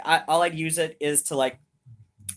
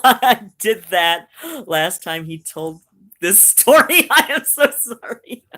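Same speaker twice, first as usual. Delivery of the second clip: loud, laughing, higher apology; 3 semitones higher, 9 dB louder than usual. Real, not acted. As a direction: apologise while cracking up.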